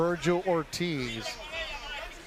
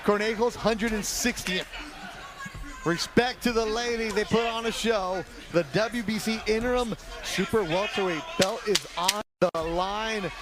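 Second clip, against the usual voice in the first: hype announcer; 4 semitones higher than usual. Real, not acted. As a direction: stretch out the final seconds. Closing seconds call.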